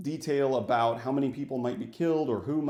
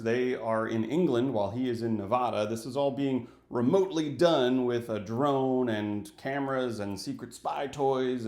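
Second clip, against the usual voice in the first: ordinary delivery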